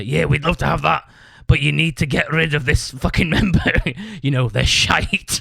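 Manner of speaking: mock Scottish promoter, breaking into a laugh; Scottish accent. Promoter demands a member be dropped, put-on Scottish accent. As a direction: imitate a blunt Scottish promoter. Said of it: "do you like my Scottish accent".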